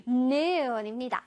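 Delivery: usual speaking voice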